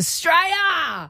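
playful drawn-out cry of Australia; hammed-up Aussie cheer; mock patriotic cheer. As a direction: call it out like a cheeky rallying cry. Playful patriotic cry ending her plan.

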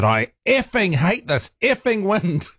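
half-hearted replay of a loud curse while cycling; retold loud outburst. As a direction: grumble it up high and drawn-out, like cursing the weather to yourself. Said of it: "really quite loudly".

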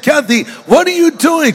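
high, outraged cry of disbelief; shocked yelp at his wife; husband shouts at wife who shot the mule, outraged. Retold or imitated. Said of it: "I was outraged".

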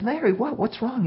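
put-on high child voice, concerned; high boy voice asking what's wrong; worried kid. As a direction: ask gently as a young boy. Kid asks crying boy.

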